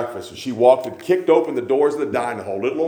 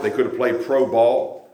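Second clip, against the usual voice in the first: usual speaking voice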